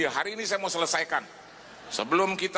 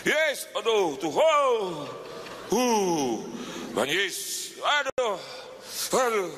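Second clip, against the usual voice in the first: panicked groans; 2 semitones higher, much slower than usual. Retold or imitated. Preacher reenacting panicked moaning on the plane. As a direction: moan in fear.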